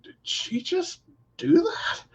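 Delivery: high incredulous squeal; mock disbelief. Bystander reacting to a shocking moment, high mock disbelief. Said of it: "you go".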